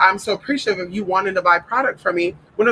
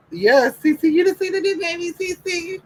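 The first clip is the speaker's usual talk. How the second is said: much higher, gushing sing-song over the new puppy; 13 semitones higher than usual. Real, not acted.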